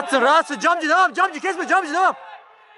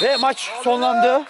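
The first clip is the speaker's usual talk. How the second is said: slow final call; 7 semitones higher, much slower than usual. Real, not acted.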